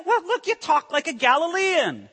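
high, mock accusing voice; accusing bystander. Preacher voicing bystanders who catch Peter's accent, high and accusing.

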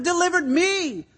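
a lightly voiced bewildered Peter; Peter asking why me. Peter asking why he was spared, lightly voiced wonder. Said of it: "It's almost he's asking".

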